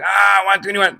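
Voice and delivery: imitating panic at speed; alarmed speed call. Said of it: "I will go".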